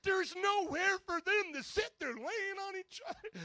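very high, exasperated yell; yelled protest. Retold or imitated. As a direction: squawk back in disbelief. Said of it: "Glenn and I would yell at each other".

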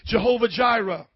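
ordinary delivery

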